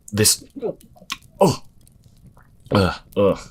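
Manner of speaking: loud retching ughs; nauseous groans. Acted. Atlas reeling from the dusk, loud queasy groans. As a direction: choke out the ughs as if sick.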